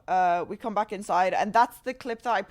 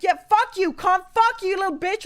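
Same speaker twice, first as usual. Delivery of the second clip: higher, louder mock yell of insults; retold screaming; 8 semitones higher, 5 dB louder than usual. Chasing the car downhill, a higher and louder mock yell. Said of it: "I'm screaming".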